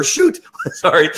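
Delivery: real laugh breaking into the words; laughing apology. Real, not acted. Trainer catches a swear word before his daughter, laughing apology.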